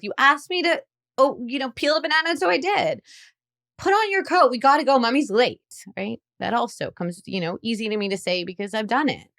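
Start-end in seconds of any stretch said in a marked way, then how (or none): 0.0-3.0 high, snide mock retort; sarcastic parent. Fed-up parent mocking a child over a banana, sarcastic. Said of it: "Sometimes we will respond with". 3.8-5.6 high, loud, hurried snap; impatient parent. Retold or imitated. Late mother ordering her child into a coat, impatient and loud. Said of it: "Sometimes we will respond with".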